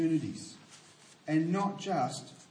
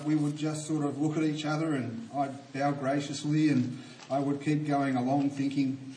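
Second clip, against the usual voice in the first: normal talking voice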